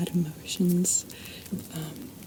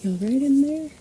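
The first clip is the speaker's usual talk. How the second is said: higher, louder check on someone in the next room; calling out; 7 semitones higher, 7 dB louder than usual. Real, not acted. Host calling to Thomas after a crash, raised voice. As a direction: call through the door to see if he's okay.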